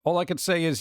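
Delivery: usual speaking voice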